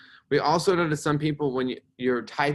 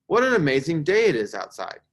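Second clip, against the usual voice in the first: higher, louder enthusiastic exclamation; exclaiming; 5 semitones higher, 6 dB louder than usual. Shown as a demonstration. Teacher reads an exclamatory example to class, exclaiming. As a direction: exclaim it with delighted emphasis. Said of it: "this ends with an exclamation point".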